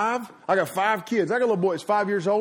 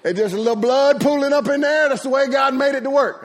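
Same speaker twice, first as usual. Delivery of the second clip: high, loud mock-mama voice; dismissive mama; 8 semitones higher, 6 dB louder than usual. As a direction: play mama loudly brushing off the injury. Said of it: "She was like".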